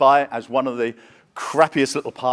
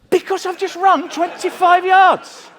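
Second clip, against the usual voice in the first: high, loud, slow, puffing reply; hammed-up breathless answer; mock out of breath; 9 dB louder, slower than usual. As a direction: gasp it out like you've just sprinted. Acting out of breath after running.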